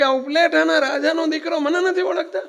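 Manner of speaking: high, loud, laughing brag; bragging prince to the monks; arrogant boast. Acted. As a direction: puff up as the king's son. Prince boasting his rank.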